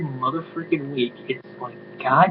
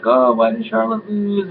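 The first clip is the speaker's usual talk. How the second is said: higher, whiny mock fan question; mock fan whine; 6 semitones higher than usual. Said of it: "I know people were like".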